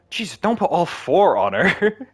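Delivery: high, slow, loud mock shout of Jesus; shocked dad shout. Dad yelling at son not to pile on blankets, loud shock.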